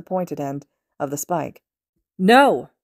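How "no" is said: sharp loud cry of alarm; shout. A narrator's reading. Todoroki seeing Monoma about to be impaled, loud alarmed shout. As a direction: yell it to stop the strike. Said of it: "Todoroki shouted".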